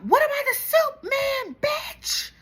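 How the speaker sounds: high, hammed-up yell of an insult; mock yell. [name] yells a taunt at Angie across the room, high mock yell. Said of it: "[name] yells out".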